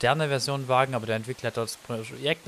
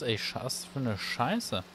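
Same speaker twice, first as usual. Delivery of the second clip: ordinary delivery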